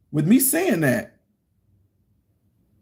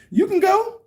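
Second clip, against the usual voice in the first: mock dismissive. Telling wife to go alone.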